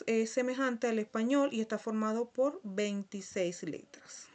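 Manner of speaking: ordinary talk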